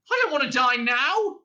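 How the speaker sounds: high, loud panicky protest; Arthur yelling. A narrator's reading. Prisoner facing death, yelling in protest. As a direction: yell like a wronged man. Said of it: "he yelled".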